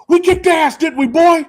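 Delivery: very high, loud gleeful holler. Retold or imitated. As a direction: crow it into the kid's ear. Dad to kid, gleeful brag.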